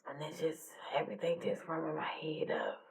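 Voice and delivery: very soft, voice falling to a whisper; real grief, faint and breathy; overcome with grief. Real, not acted. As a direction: barely voice it, swamped by memories. Overcome by memories of dead friend.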